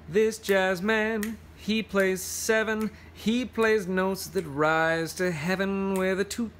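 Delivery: slow, sing-song picture-book verse; reading to children. Acted. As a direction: lean into the rhythm of the rhyme. Reads picture book aloud.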